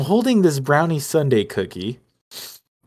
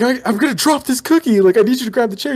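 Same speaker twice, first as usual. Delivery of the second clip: urgent pleading shout; 12 semitones higher, 4 dB louder, faster than usual. Retold or imitated. Panicking over collapsing cookie.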